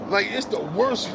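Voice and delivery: usual speaking voice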